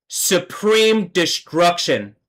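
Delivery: drawn-out solemn command; slow proclaiming. Real, not acted. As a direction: stretch "supreme destruction" out grandly. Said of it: "let me affirm".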